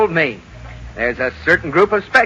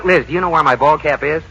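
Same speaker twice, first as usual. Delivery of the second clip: normal talking voice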